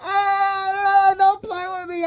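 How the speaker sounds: high, wheezing laugh into high words; cry-laughing. Real, not acted. Reader begging the Lord while howling with laughter. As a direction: laugh until it breaks into a plea. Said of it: "let me stop laughing".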